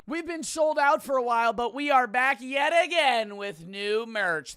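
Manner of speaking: high, loud promo voice for his merch; hyped merch ad read. Acted. Host pitches his new merch to viewers, hyped ad read. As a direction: sell it like a big announcement.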